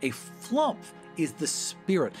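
ordinary delivery

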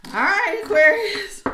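calling out Aquarius with a jolt; excited call. Real, not acted. Reader perking up at the cards for Aquarius.

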